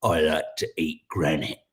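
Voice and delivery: a hint of a big lazy lizard; host imitating an alien lizard, a low mock creature voice. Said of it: "a great big lizard that goes".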